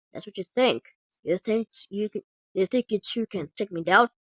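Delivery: ordinary talk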